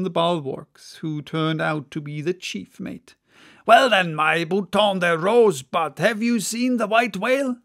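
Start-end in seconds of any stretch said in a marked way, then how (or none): 3.6-7.6 louder, higher hail; calling out. Acted. Stubb calls to the Guernsey mate, hailing. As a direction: call across to the other deck. Stubb hails across water.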